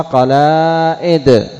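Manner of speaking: قلائد slow, long aa then glottal stop; hamza replacing alif. Shown as a demonstration. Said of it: "تنطقها".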